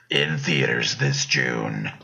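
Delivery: very low, slow trailer announcer; deep dramatic movie guy. Pretend. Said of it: "be the movie guy and just be like".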